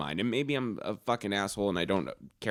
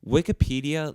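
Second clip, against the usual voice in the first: ordinary delivery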